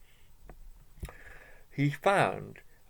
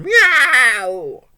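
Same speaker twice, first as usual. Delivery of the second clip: high, loud yowling meow; yelping cat meow; 10 semitones higher, 11 dB louder than usual. Acted. Cat prodded with a chair, yelping a loud high meow. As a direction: yowl like a startled cat. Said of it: "He gave a yelp".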